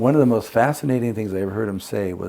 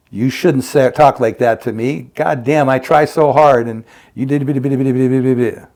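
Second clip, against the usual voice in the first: louder exasperated complaint, acted out; mock angry rant; 3 semitones higher, 8 dB louder than usual. Illustrating a harmful thought, acted as a loud, aggrieved rant. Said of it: "life-alienating thought of".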